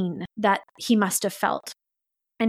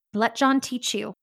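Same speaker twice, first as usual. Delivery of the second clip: ordinary delivery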